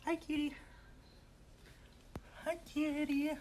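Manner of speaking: high, soft hi kitty; soft cat-calling. Real, not acted. Owner softly coaxing the cat.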